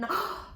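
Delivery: a quick high mock gasp of alarm; playful gasp. Teacher pretends the fingers nearly got bitten, mock gasp.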